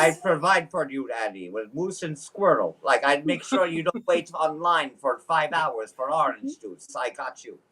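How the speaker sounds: put-on comic Russian-accented provider; playful mock-Russian delivery. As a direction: reassure her in a hammed-up Russian accent. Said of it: "trying to hone in on my Russian accent".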